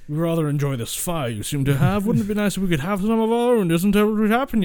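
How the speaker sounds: mock-refined creature, rising in pitch and volume; sophisticated hobgoblin. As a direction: play an overly polite hobgoblin making a request. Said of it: "started speaking like this".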